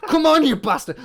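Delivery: loud, high, exasperated snarl at the sparklers; frustrated curse. Retold or imitated. Frustrated groom cursing damp sparklers, loud and high.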